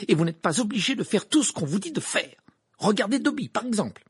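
loud, high, impassioned tirade; angry. A narrator's reading. Hermione rallies the elves to their rights, loud and angry. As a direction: harangue the whole room at high pitch. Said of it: "dit Hermione avec colère".

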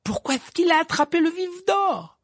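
high, baffled yell; incredulous high-pitched cry; shouting. A narrator's reading. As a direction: shout the question over a cheering crowd. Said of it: "cria Ron".

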